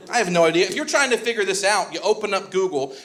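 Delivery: ordinary talk, nothing marked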